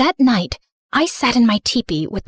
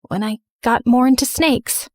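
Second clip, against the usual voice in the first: plain reading voice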